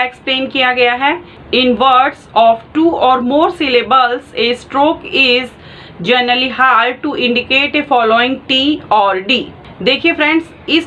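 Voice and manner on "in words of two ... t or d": very slow reading, each word spaced out; slow dictation. Shown as a demonstration. Shorthand teacher reading the textbook rule slowly to her students. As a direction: read the rule out slowly so students can write it.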